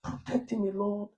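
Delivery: a preacher showing how he prays in English, slow and hushed; English prayer. Retold or imitated. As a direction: pray it slowly in a hushed, lifted voice. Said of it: "영어로 그래".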